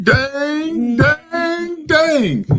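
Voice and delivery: high, loud drawn-out dismay; dismayed exclamation. Real, not acted. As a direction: cry out in dismay. Mourning news, high far exclamation.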